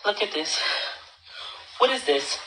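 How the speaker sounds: normal talking voice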